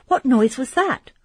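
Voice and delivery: frightened question. Acted. Emily frightened by a noise.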